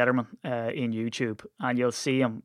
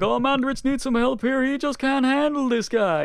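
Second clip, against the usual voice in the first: mocking commentators; 13 semitones higher, 4 dB louder than usual. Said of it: "And they're like".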